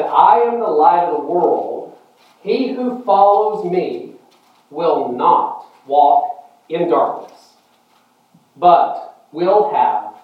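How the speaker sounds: loud, slow, deliberate Bible quotation; declaimed verse from the pulpit; scripture proclamation. Acted. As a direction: proclaim it slowly and loudly, with weight on each phrase. Quotes scripture to congregation.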